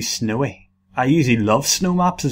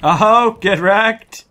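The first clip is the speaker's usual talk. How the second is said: loud, high taunt; gloating shout; 7 semitones higher, 7 dB louder, much slower than usual. Real, not acted. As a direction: crow over the kill. Gloating after a kill.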